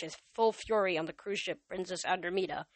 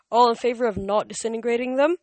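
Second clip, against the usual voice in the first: loud, higher, brisk question; brisk vote call; 5 semitones higher, 9 dB louder than usual. A narrator's reading. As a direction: rush the motion through. Hermes calls the vote.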